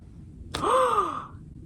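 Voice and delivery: high, shocked gasp; dramatic gasp. Pretend. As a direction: gasp at the big reveal. Reveal of Thaumaturge Haddon.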